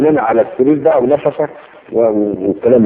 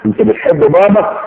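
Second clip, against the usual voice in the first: put-on high, loving coo to the baby; sweet baby talk; 7 semitones higher than usual. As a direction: praise the baby like a proud mother. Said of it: "وهي تقول له". (صوت حنون لطيف للطفل)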